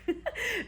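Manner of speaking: bright, high burst of giggling; high giggle. Real, not acted. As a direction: let out a quick, high laugh. Laughs at the line about traffic.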